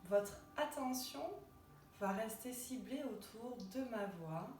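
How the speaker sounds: unhurried relaxation voice with long pauses; guided meditation. Acted. Yoga teacher guiding listeners lying in Shavasana, calm guided meditation. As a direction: guide them gently into rest, phrase by phrase.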